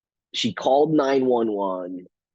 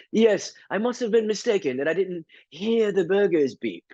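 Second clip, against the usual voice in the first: put-on high, proper English-accented voice; a stiffly proper apology; prim British cashier; 9 semitones higher than usual. Mimicking the cashier admitting she was mistaken. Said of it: "super proper".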